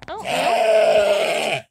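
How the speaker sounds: long loud call of the lamb's name. Real, not acted. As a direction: call her over from far away. Calls a lamb by name.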